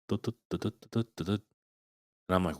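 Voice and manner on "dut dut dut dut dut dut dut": soft rhythmic dut dut thuds, mouthed like footsteps on a roof; hushed imitation of running on the roof; imitated thudding. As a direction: tap out the thuds quietly with your voice. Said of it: "I hear thudding noises".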